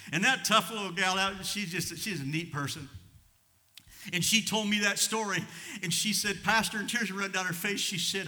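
loud, high preaching voice; fervent preaching. Acted. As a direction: preach it with rising fire to the congregation. Preaching at full force.

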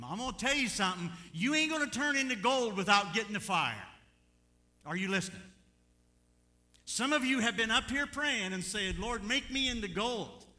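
loud, high, fervent preacher's cry; preaching at full force. Acted. Preacher lifts the congregation, shouting high and loud. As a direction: drive it home to the congregation.

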